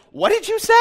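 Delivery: high, incredulous squeak; stunned disbelief. Retold or imitated. Man retelling asking the foster kid, stunned and high. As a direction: retell his shocked, high-pitched question. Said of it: "had the audacity to ask the dumbest question".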